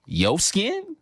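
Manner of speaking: high, rising disbelief; incredulous. Acted. Pupil questioning teacher about skin superiority, incredulous. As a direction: push the question up in mock bafflement.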